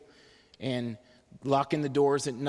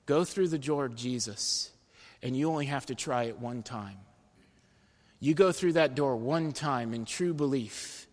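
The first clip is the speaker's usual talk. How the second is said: ordinary talk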